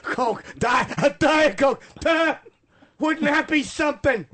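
hammed-up customer yelling for a Diet Coke; mock shouted order.

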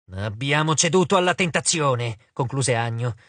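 plain reading voice

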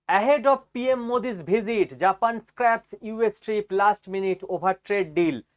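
very slow, deliberate reading; slow headline read. Acted. Showing viewers the Reuters headline, slow read.

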